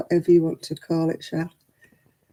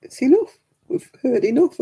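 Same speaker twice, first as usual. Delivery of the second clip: much higher, firm telling-off; firm grandmother; 8 semitones higher than usual. Retold or imitated. Grandmother telling off the grandkids, high and firm. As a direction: stop the grandkids in their tracks. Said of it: "and I say".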